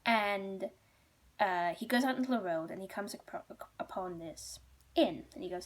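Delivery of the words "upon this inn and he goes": plain reading voice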